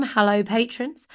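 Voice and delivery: ordinary delivery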